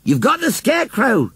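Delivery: high-pitched postman's shout. A narrator's reading. Postman tells the dozy old lady, shouting. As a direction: call it out to make her hear. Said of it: "shouted the postman".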